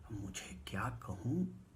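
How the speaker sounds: slow soft whisper. Real, not acted. Narrator speechless before the note, slow whisper. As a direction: breathe it out, lost for words. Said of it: "क्या कहूँ हो गई".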